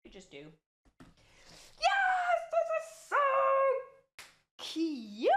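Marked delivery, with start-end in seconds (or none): 1.8-5.3 very high, loud delighted squeal; excited squeal. Real, not acted. Crafter thrilled with the project, squealing.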